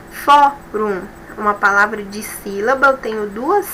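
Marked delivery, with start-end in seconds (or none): 0.1-1.0 Fórum with the first syllable FÓ punched; stress on FÓ. Shown as a demonstration. Teacher to students, showing where Fórum's stress falls. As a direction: hit FÓ in Fórum, let -rum fall away. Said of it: "a penúltima sílaba é a mais forte quando eu pronuncio".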